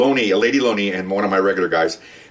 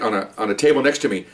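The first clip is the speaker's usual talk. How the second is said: usual speaking voice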